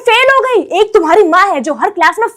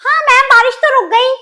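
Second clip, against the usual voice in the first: loud retort; 8 semitones higher, 4 dB louder than usual. Acted. Kid retorts to teacher.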